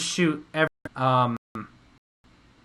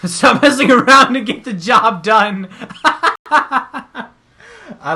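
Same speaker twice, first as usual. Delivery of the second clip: laughing; 9 semitones higher, 9 dB louder, much slower than usual. Real, not acted. Laughing, scolding self.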